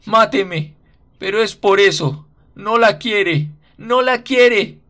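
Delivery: high, very loud, crazed scream; frantic scream. A narrator's reading. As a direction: scream it out of your mind with despair. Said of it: "grita enloquecido".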